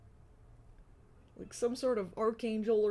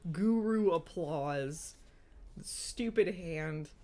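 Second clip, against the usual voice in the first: slow exasperated; 3 semitones lower, much slower than usual. Real, not acted.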